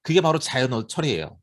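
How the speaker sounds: normal talking voice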